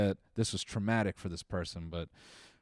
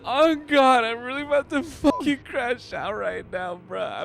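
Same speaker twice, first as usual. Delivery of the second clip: high, wailing mock meltdown, much louder; crying college student; 14 semitones higher, 6 dB louder, slower than usual. Mocking a crying student upset about her roommate, high wailing whine. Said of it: "a crying college student going".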